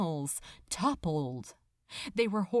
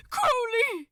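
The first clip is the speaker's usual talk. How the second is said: high, loud cry of his name; frightened cry; 12 semitones higher, 4 dB louder than usual. Acted. Aziraphale cries to Crowley, slipping, frightened. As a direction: call out as your grip slips. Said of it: "the angel cried".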